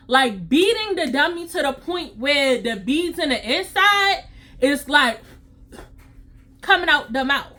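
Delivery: ordinary delivery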